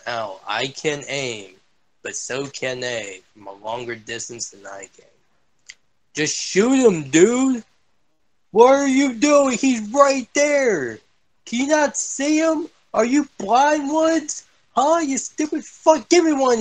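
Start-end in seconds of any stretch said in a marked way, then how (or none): 6.1-16.6 higher pitched, frustrated rant at a teammate; exasperated yelling. Real, not acted. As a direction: shout at him in disbelief.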